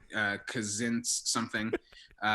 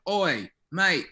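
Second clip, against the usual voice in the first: mock Cockney bloke, a bit higher; imitating British lads heckling; hammed-up British accent, higher and a touch louder; 5 semitones higher, 3 dB louder than usual. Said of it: "some British guys were just going".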